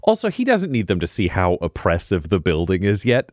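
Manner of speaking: ordinary talk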